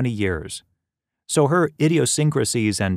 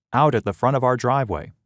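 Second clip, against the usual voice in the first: ordinary delivery, nothing marked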